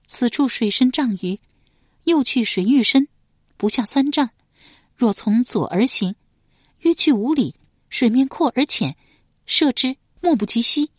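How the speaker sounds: bright guide describing the river; 少女清亮地讲解. Acted.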